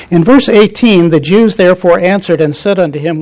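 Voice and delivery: calling the class back with a strong voice; loud reading. Real, not acted. Teacher calls the brothers back to the text, loudly.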